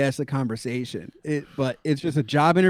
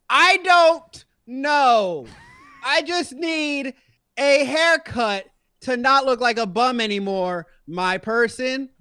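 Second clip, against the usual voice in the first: higher, very slow mock drawl of a tired customer; mock weary customer; 9 semitones higher, slower than usual. Customer answering the barber's questions, in a slow mock drawl. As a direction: drag it out like you can't be bothered.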